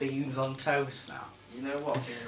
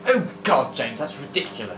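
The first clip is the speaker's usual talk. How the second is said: Gabe impression; 2 semitones higher than usual. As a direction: imitate a friend, pitched up on the first words. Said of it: "Go on, you do it".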